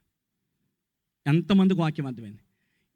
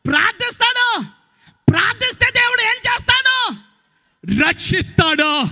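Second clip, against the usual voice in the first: preaching at full force; 13 semitones higher than usual. Real, not acted. Preacher quizzing the church, at full force. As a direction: build each question up.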